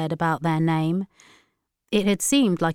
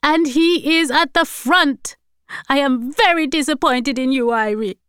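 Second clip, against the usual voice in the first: loud scolding; 9 semitones higher, 6 dB louder than usual. A narrator's reading. Grandmother disappointed in granddaughter, loud scolding. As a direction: hammer it out, then scold.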